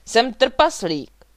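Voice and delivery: loud, slow, emphatic announcement; roaring. A narrator's reading. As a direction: bellow it out, drawing it out. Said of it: "zařval Vladimír".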